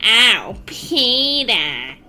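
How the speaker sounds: low, slurred cartoon mom voice; Lois Griffin impression. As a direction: do a Lois from Family Guy. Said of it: "There is your Lois".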